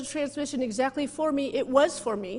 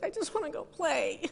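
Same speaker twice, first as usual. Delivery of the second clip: higher, childlike mock whine; little girl whining; 6 semitones higher, 4 dB quieter than usual. Pretending to be a little girl begging to go play, higher childish whine. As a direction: play the little girl who just wants out. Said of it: "like the little girls".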